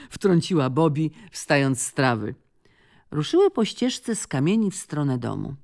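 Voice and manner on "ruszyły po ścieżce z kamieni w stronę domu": ordinary delivery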